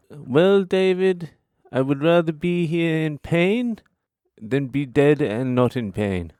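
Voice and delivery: imitating nana in a high Polish accent. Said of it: "stop doing the fucking Polish accent".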